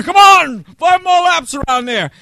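high, loud barking at recruits to run; a loud drill instructor shout from the track; drill instructor yelling. Retold or imitated. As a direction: yell laps at them like a drill sergeant. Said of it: "he could scream like nobody".

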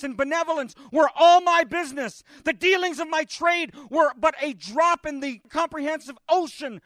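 lightly voiced ghost lament. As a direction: plead like a haunted man. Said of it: "the ghost cried, wringing his hands".

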